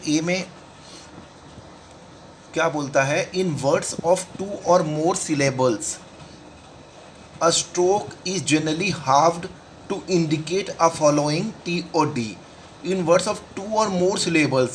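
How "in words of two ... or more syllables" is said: slow, word-by-word reading of a rule; measured textbook read-aloud; slow dictation. Shown as a demonstration. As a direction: dictate it slowly to the class.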